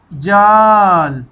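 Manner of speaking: जाल stretched very slowly as one joined word; slow blend. Shown as a demonstration. Teacher modelling the joined word for pupils, slowly. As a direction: hold the long आ of जाल, then land on ल. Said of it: "इकट्ठा पढ़ना है".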